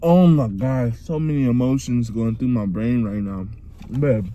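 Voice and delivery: talking with food in mouth; words muffled by chewing. Real, not acted. Seen 1: bit at -12, jaw and lips still working through the words.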